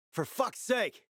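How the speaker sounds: high, sharp curse of frustration; swearing in disgust at the carnage; angry outburst. Acted. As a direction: explode at the mess in front of you. Finding a bloody death scene.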